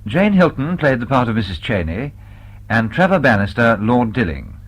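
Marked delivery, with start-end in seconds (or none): none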